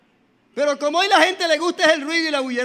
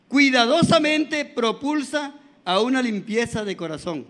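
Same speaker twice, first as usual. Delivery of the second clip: usual speaking voice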